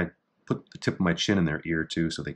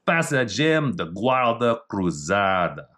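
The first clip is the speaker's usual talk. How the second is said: Portuguese reading; slower than usual. Shown as a demonstration. Reading Portuguese technique name.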